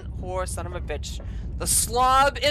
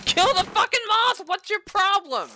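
high, loud frantic scolding; frantic anger; 9 semitones higher, 3 dB louder than usual. Real, not acted. Player demanding the moth be killed, frantic anger. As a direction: yell it in real exasperation. Yelling about moth on back.

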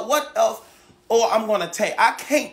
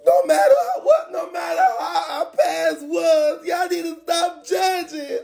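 real sobbing shout, much higher; crying shout; 8 semitones higher than usual. Real, not acted. To viewers asking why he's crying, sobbing shout. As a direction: cry it out through laughs and tears. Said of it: "y'all saying why I'm crying".

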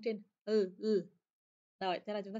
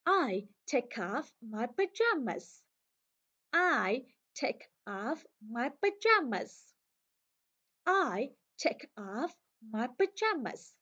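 slow, with "pajamas" raised and leaned on; stress on PAJAMAS; 2 semitones higher, much slower than usual. Shown as a demonstration. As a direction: punch the word "pajamas" slowly. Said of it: "nhấn mạnh cái chữ pajamas". (nhấn mạnh và lên giọng chữ "pajamas")

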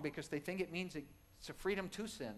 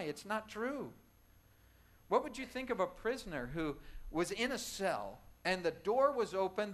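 ordinary talk

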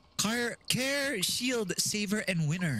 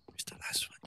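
whispered search; whispering; 10 dB quieter than usual. Real, not acted. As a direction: breathe it out in a whisper. Searching in game.

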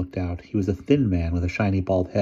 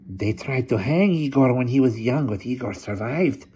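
hunchback assistant; 5 semitones higher than usual. A narrator's reading. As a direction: speak like a sly old hunchback.

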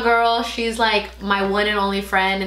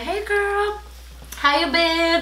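put-on high, chirpy "hey girl" voice; sing-song high girly hello; bubbly greeting; 8 semitones higher, much slower than usual. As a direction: greet her like an old best friend, all sweetness. Greeting old school friend.

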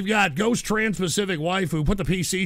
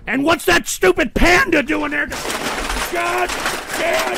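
louder, higher exasperated burst; angry outburst; 8 semitones higher, 4 dB louder than usual. Real, not acted. Streamer losing his temper mid-recitation, angry outburst. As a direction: snap in loud exasperation.

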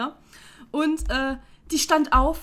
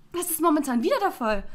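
ordinary delivery

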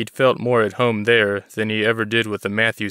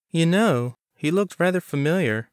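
much higher woman's voice, sly and confiding; conspiratorial; 8 semitones higher than usual. A narrator's reading. Clerk Bonnie hinting to Chris she knows, high conspiratorial voice. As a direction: lean in and hint at a secret. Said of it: "said with a conspiratorial tone".

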